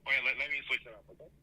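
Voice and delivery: distant voice over the phone; phone speaker. Real, not acted. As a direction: caller away from the mic. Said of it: "away from your microphone or something".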